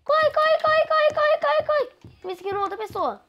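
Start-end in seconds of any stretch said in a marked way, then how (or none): 0.0-2.2 rising panic, climbing to a high frantic pitch; urging on in a frightened rush; being chased. Real, not acted. Fleeing the Pig in Roblox.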